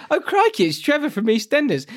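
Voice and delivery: high, loud mock shock; startled exclamation. Reacting to Trevor appearing on screen, loud mock shock. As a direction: play up the shock of spotting him. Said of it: "I was like".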